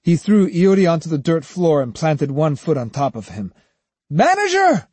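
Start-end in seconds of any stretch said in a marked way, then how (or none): 4.1-4.9 high, loud furious call; angry call. A narrator's reading. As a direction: bark for the manager. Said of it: "he called angrily".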